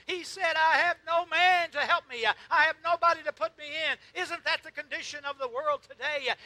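high, impassioned pulpit cry; loud, urgent preaching; full-force preaching. Real, not acted. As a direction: cry out the need, then the answer.

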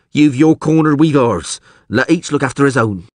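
high, loud commoner's voice; high, insistent jailbird; second thief, standoffish. Acted. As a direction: warn them off as a petty crook.

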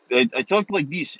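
a light put-on Russian accent, showing how he talked. Shown as a demonstration. Podcaster showing listeners his character's Russian accent. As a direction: slip into a mild Russian accent for the demo. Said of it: "talk with a Russian accent".

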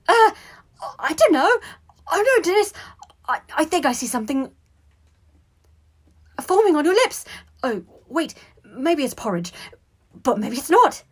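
high, loud, stammering squeak; panic. A narrator's reading. Brother spotting something on the boy's lips, panicking. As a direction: flap and dither in squeaky alarm. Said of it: "in a panic".

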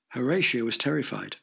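plain reading voice

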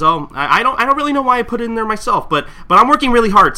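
high, loud, fast flustered excuse; Kagura excuse. Retold or imitated. Kagura excusing herself to the customer, high and flustered. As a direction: blurt the excuse way up high and hurried. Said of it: "and Kagura just says".

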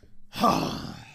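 long exhaled sigh; exasperated, breathy sigh; heavy sigh. Real, not acted. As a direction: let the rant out in one breath. Sighs after finishing the rant.

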